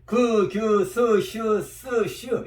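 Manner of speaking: 그, 규, 스, 슈 recited in pairs, adding a y-glide; plain-to-glide syllable drill. Shown as a demonstration. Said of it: "이렇게".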